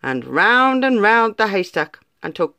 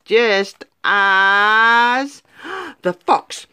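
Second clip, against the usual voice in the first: suspenseful; 2 semitones higher, much slower than usual. A narrator's reading. Suspense before the snap.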